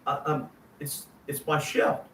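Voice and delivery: taken-aback stammer; stammering meek reply. Retold or imitated. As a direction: shrink back, stunned and unsure. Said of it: "I'm like".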